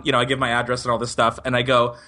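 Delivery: ordinary delivery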